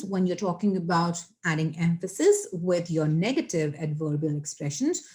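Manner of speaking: ordinary delivery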